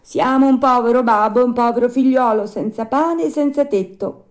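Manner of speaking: high, loud puppet voice; Pinocchio pleading at the door. Acted. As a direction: plead for shelter as the puppet son.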